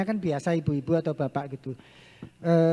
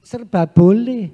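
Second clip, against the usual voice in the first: emphatic; 2 semitones higher, 11 dB louder, slower than usual. Real, not acted. Emphatic point to parents.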